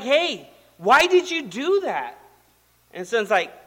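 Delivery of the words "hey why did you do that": high, loud, slow demand from a dad; indignant scolding. Retold or imitated. Father to toddler who just smacked him, indignant scolding. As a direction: imitate a shocked father taking his child to task.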